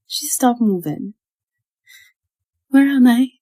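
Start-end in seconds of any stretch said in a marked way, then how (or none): 2.7-3.3 high, loud tearful question; crying. A narrator's reading. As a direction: ask through tears, lost. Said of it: "she asked, crying".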